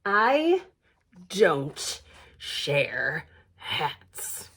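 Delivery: low slow growl; growling bear. A narrator's reading. Grumpy bear growling at Puffin who woke her. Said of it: "Hetty growled".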